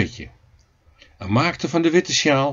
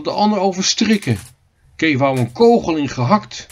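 ordinary delivery, nothing marked

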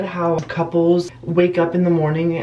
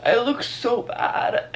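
shocked whisper; 4 semitones higher, 5 dB quieter, slower than usual. Real, not acted. Seeing herself in camera.

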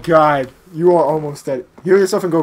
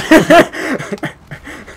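high, loud burst of laughter; laughing; 10 semitones higher, 7 dB louder than usual. Real, not acted. Warns the viewers jokingly, then a loud laugh. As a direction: crack up loudly.

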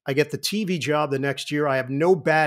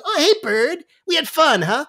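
much higher, louder cheerful friend; imitating Bill Raftery on a treadmill; 12 semitones higher, 4 dB louder than usual. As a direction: chirp it like Raftery. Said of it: "he'd be doing his dopey walk".

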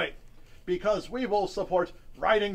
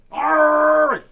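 big higher bellowed pirate "arr"; loud pirate shout; 7 semitones higher, 13 dB louder than usual. Pretend. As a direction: roar a long pirate arr. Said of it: "Talk Like a Pirate Day".

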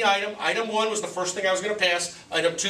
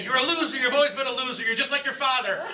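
much higher mock mother's scold; lightly voiced mother calling him a loser; scolding mom; 6 semitones higher than usual. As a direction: imitate a fed-up mom putting him down. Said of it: "she's gonna say".

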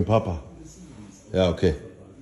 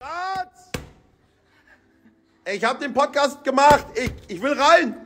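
excited calling; 14 semitones higher than usual. Real, not acted.

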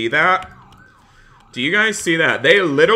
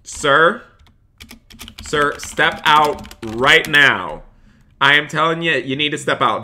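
stern cop; 2 semitones higher, 4 dB louder than usual. Acted. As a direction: order the driver out like a strict officer. In-game cop ordering a driver.

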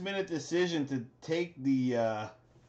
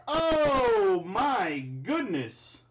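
high, slow cry of amazement, louder; stunned excitement; 4 semitones higher, 4 dB louder, much slower than usual. Real, not acted. Opening a pack and hitting a huge card, in real stunned delight. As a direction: overcome by a massive pull.

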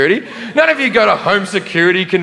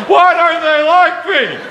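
high, slow hammed-up weepy whine; mock sobbing whine; 10 semitones higher, slower than usual. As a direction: whimper it like a crushed teacher. Teacher crying in car.

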